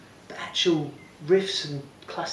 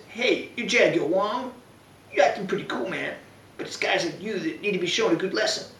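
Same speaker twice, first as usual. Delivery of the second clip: put-on Brooklyn accent; 5 semitones higher than usual. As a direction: sneer it like a Brooklyn tough. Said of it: "we could do the Texan accents".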